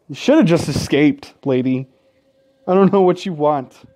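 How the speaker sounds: ordinary talk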